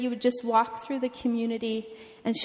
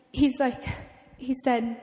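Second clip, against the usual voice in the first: ordinary delivery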